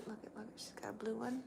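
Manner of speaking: quick hushed whisper. Real, not acted. Owner showing the dog's odd eyes, whispering. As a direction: whisper fast while pointing it out.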